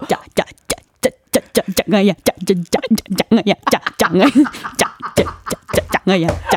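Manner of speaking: lightly voiced cartoon boy, stuttering; like a glitching cartoon kid; buffering Jjanggu impression. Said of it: "버퍼링 걸린 짝꿍 가능해요".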